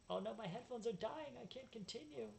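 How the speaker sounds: high-pitched, hammed-up dismay; mock distress. Player laments dying headphones, mock distress. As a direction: whine it high, as pretend despair.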